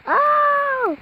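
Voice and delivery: high, drawn-out mock wail. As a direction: wail in play panic. Playing at disaster, screaming.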